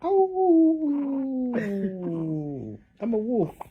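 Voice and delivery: high put-on wolf voice for the baby; playful wolf voice. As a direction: play a goofy wolf introducing himself. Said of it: "the wolf goes".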